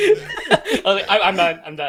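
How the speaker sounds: laughing giving up, high and loud. Retold or imitated. Friend laughing about quitting the game, loud and laughing. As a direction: laugh through a mock quit.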